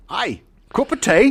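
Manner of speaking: high, loud mock Cockney; bad Cockney accent. Podcast host mocking an actor's fake accent, in bad Cockney. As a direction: ham up a terrible Cockney accent. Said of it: "horrible Cockney accent".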